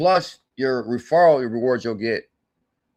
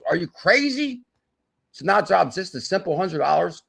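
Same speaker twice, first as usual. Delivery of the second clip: pitched way up in disbelief; incredulous; 6 semitones higher than usual. Real, not acted. Telling viewers how cheap the buy-in is, incredulous high question. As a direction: ask it like the idea is unbelievable.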